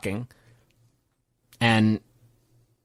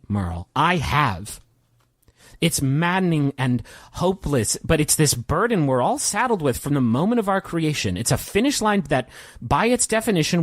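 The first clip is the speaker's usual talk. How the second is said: higher-pitched, mounting despairing rant; carried away; 5 semitones higher than usual. Acted. John tells Merle existence is horrible, getting carried away. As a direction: let the bleak monologue run away with you, pitch swinging up on key words. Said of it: "realizes he got a little carried away".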